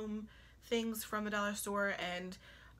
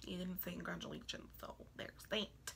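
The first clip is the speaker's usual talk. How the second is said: hushed, breathy-soft pointed aside; whispery little voice; 7 dB quieter than usual. Real, not acted. Vlogger to absent guests, hushed sly whisper. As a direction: drop to a sly whisper for the jab. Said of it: "I don't know why I do that voice".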